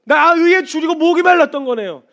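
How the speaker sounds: 나 의에 줄이고 목이 말랐던 거네요 proclaimed high and loud; loud fervent confession. Retold or imitated. Believer confessing thirst to the Lord, full force. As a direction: preach the line at full force. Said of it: "표현해 주시는 이게".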